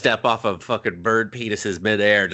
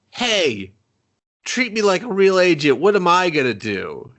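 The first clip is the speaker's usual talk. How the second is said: mock hero whine; 7 semitones higher, 3 dB louder than usual. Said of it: "he's just like".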